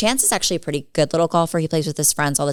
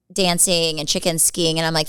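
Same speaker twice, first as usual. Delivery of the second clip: usual speaking voice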